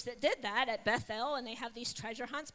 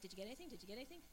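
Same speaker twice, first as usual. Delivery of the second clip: much softer, hushed asking; quiet, hushed; 4 semitones lower, 17 dB quieter, much faster than usual. Retold or imitated. The girl quietly nudging the pastor's wife, hushed. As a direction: keep it low so nobody hears. Girl whispering at the food court.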